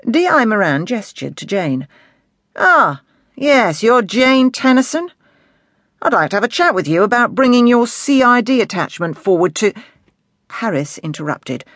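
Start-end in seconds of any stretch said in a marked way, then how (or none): 2.6-9.7 raised, emphatic inspector's voice; affable senior inspector. Acted. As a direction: greet a junior officer with easy authority. Inspector addresses the young officer.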